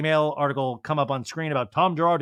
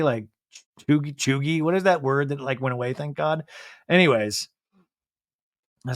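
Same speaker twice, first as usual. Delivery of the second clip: ordinary delivery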